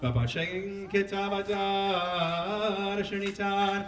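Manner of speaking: steady liturgical chanting of scripture; a cantor reading the scroll; Megillah cantillation. Acted. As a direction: chant the Hebrew verses in the traditional trope. Chanting Megillah chapter four.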